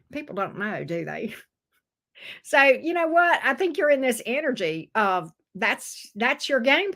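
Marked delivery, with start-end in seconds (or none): none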